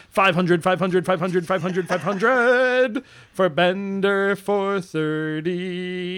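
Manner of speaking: repeated chant stretching out at the end; hammed-up milestone announcement; announcing a milestone. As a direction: chant it over and over, then draw out the name. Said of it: "Well, I'm saying it".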